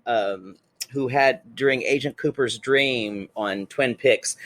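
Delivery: normal talking voice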